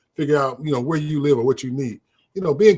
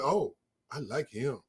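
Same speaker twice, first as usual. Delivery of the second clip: soft, slow, much quieter swooning voice; lovestruck patient; 7 dB quieter, slower than usual. Retold or imitated. A patient falling for the officer Herbert, soft and swooning. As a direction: breathe it out like she's instantly smitten. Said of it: "she went".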